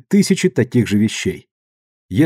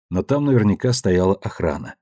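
plain reading voice

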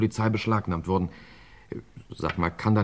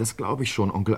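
ordinary talk

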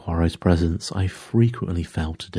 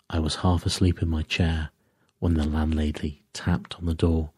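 ordinary delivery, nothing marked

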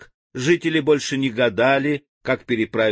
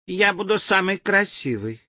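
put-on girl voice; 7 semitones higher than usual. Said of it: "говорила одна девочка".

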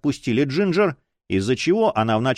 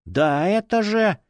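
indignant; 8 semitones higher, slower than usual. A narrator's reading. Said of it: "возмутился фанатик".

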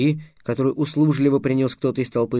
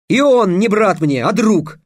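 loud, high, emphatic declaration; roared retort; 7 semitones higher, 6 dB louder, slower than usual. A narrator's reading. Trix insisting the captive is his friend, roaring. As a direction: drive the correction home forcefully. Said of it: "рявкнул Трикс".

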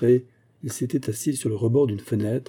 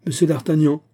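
louder, higher formal hail; calling aloud; 5 semitones higher, 5 dB louder than usual. A narrator's reading. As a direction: call out his name to get his attention. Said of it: "dit-il tout haut".

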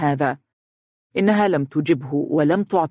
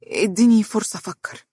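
hesitant, higher-pitched request; slow, sombre; 5 semitones higher than usual. A narrator's reading. Employee asking her would-be boss for time, slowly. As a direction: ask for time haltingly. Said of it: "وهي تقول ببطء".

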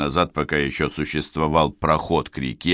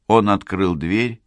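ordinary delivery

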